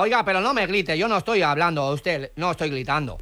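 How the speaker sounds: put-on foreign accent with L for R; foreign-accented broken Spanish; mock foreign accent. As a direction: play a foreigner mangling Spanish, R turned to L. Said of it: "si no sabe hablar castellano".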